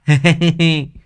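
high, teasing giggle; gloating laugh. A narrator's reading. Boy claiming to be the elder, cheeky laugh. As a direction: laugh at winning the age contest.

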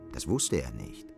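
normal talking voice

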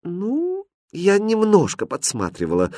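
sheepish, drawn-up little voice; embarrassed confession. A narrator's reading. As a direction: own up shyly. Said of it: "смущённо призналась Катринка".

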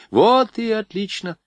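high, slow delighted cry; clapping her hands with joy. A narrator's reading. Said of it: "захлопала в ладоши Варя".

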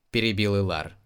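ordinary delivery

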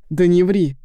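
громко и резко, обрывая; крикнуть с яростью; громкий окрик. A narrator's reading. Драка, обвинение во лжи. (громкий злой окрик)

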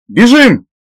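high urgent yell; shout. A narrator's reading. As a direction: shout the warning to flee. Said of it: "тут же крикнул Рене".

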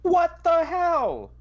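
high-pitched, slow dismayed cry; shocked outburst. Real, not acted. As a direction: shriek in disbelief. Piggy wings missing from menu.

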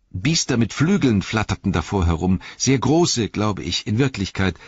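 high-voiced, shaken hobbit recounting a vision; Pippin. A narrator's reading. (aufgewühlter Hobbit, hohe Stimme)

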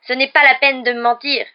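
loud, high wounded wail; mock sobbing. A narrator's reading. Ghost girl sure they are lying, loud tearful wail. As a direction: wail it as a hurt accusation. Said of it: "sanglota Mimi".

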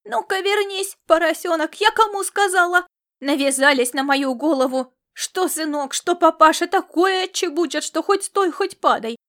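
high, loud exasperated wailing; mother complaining loudly about son and husband; lamenting scold.

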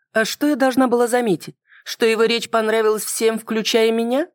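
high-pitched female character, challenging; woman's voice, piqued and questioning; defensive woman. Acted. Woman retorts to narrator.

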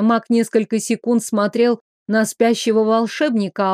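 ordinary delivery, nothing marked

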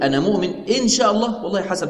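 "إن شاء الله" said with a lifted, uncertain pitch; doubting believer. Retold or imitated. Teacher voicing the wrong, doubtful intent, lightly unsure. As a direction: voice the doubter who isn't sure he believes. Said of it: "لو اعتقد كده خطأ".

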